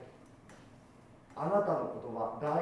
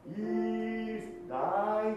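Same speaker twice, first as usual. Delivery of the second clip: dropped D; 7 semitones higher, 4 dB louder, much slower than usual. Shown as a demonstration. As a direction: let the D of ダイン fall below イース. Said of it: "ダインって絶対やらないこと". (ダインのDが下がる悪い例)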